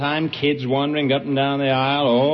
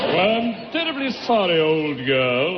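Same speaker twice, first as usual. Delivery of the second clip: put-on English accent. [name] apologises to Tallulah, English accent. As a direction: apologise like a mock English gent. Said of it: "that's a wonderful English accent".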